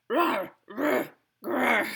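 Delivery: string of short imitated growls; muttered bear growls. A narrator's reading. Old Bear growls along in place of the blessing. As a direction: growl out a bear's grumbled words. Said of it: "Old Bear muttered and growled".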